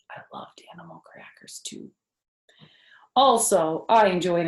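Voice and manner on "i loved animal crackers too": whispered aside. Real, not acted.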